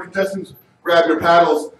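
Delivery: ordinary delivery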